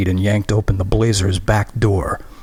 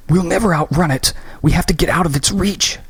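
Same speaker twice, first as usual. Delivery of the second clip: high, urgent cries; frantic shout; 9 semitones higher than usual. Acted. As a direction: shout the plan in panic. Fleeing the creature.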